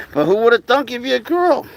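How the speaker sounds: high-pitched, cooing surprise. Real, not acted. To a baby animal revealed as a girl, amazed baby talk. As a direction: marvel at a pet in a high voice.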